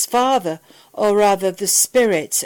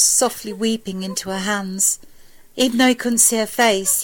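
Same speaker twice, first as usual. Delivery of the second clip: ordinary delivery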